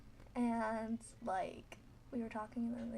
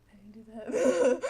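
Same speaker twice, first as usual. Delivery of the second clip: slow, laughing loud protest; giggling friend insisting; laughing denial; 14 dB louder, slower than usual. Retold or imitated. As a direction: deny it while cracking up.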